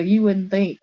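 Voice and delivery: ordinary talk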